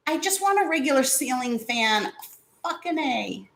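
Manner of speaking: frustrated. Real, not acted. Fighting with the fan remote.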